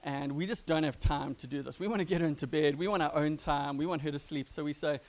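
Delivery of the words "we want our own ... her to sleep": ordinary talk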